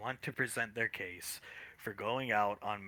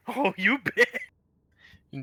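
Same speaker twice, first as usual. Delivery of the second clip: laughing outburst; 9 semitones higher, 7 dB louder, much slower than usual. Real, not acted.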